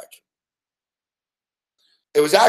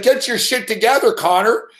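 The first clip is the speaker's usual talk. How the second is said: put-on scolding the kid; 7 semitones higher than usual. Retold on-air rant at kid.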